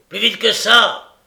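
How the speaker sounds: loud, high, impatient yell; angry shout. A narrator's reading. Sikes yells at Toby to hurry, angry shout. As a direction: drive him on with a furious cry. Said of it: "s'écria Sikes".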